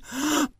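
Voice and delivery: sharp high gasp; alarmed gasp. Acted. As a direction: gasp at a sudden realization. Farmer realizes someone's stealing.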